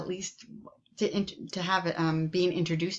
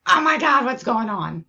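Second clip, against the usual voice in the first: loud, high-pitched mock panic; mock alarm; 4 semitones higher, 8 dB louder than usual. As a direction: burst out as the overwhelmed body, hammed-up. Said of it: "the body's like".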